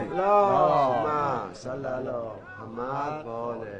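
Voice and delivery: low, very slow drawn-out prayer formula; salawat recitation. Acted. Seller recites the salawat at the elder's request, slow chant. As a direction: intone the blessing slowly and grudgingly.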